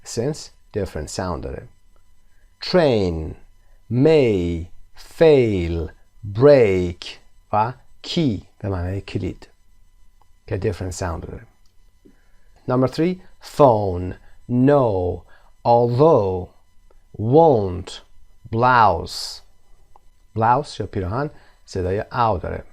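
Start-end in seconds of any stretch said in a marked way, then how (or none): 2.6-7.0 "Bike" "might" "buy" "smile" each said alone, louder; word list read aloud. Shown as a demonstration. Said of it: "کلمات رو بخونیم". 12.7-19.4 "Bike" "might" "buy" "smile" each said alone, louder; word list read aloud. Shown as a demonstration. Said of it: "کلمات رو بخونیم".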